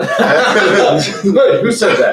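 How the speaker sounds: laughing. Real, not acted. Laughing surprise.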